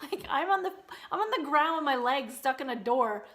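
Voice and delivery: high, loud, incredulous comeback with a laugh in it; indignant retort. Retold or imitated. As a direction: fire back in disbelief at being yelled at. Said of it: "And I'm just like".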